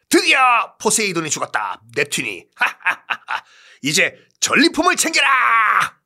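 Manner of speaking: high, laughing victory shout; triumphant proclamation. Acted. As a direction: crow over a fake victory.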